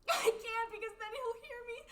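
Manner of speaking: high, loud, crying panic; panicked sobbing. Acted. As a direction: sob in terror, can't help her. Friend stops breathing, hiding.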